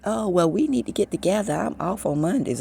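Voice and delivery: high, sing-song friendly imitation; mocking Tansy. Imitating Tansy pushing to get together, high and sugary. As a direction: mimic her overeager invite, pitched high. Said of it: "she like".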